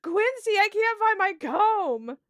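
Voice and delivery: high, fretting search for her comb; panicked girl. Acted. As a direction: fret about the lost comb in a high voice. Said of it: "She's like".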